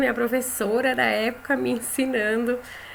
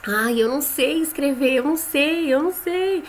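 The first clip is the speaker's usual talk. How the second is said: put-on higher, whiny child protesting; rising more with each "eu não sei", like an anxious child; criança insegura; 4 semitones higher than usual. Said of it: "muitos vão falar assim". (imitando criança choramingando "não sei escrever")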